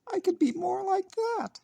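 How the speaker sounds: very high, squeaky little mouse; a wistful little mouse, squeaking high; tiny mouse voice. Acted. Mouse voice in story.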